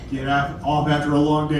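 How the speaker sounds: usual speaking voice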